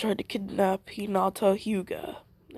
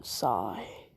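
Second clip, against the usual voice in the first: ordinary talk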